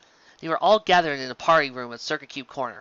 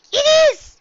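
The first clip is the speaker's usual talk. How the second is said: very high, much louder cry of joy; excited exclaim; 11 dB louder than usual. A narrator's reading. Mailmare thrilled by the map, shouting high with joy. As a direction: burst out with delight. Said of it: "Dizzy exclaimed".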